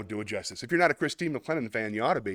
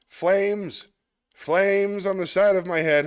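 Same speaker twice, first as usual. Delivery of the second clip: lightly voiced movie quote, higher and louder; quoting the film line; 8 semitones higher, 6 dB louder, much slower than usual. Host recalls a comic's movie bit, mock delivery. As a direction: quote the famous line with some flair. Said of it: "She did the song from Blazing Saddles".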